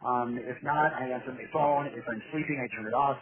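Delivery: ordinary talk, nothing marked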